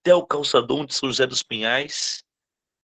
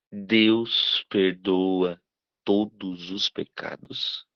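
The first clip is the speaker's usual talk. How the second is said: slow, drawn-out question; 3 semitones lower, much slower than usual. Retold or imitated. Son asks a third time, slower.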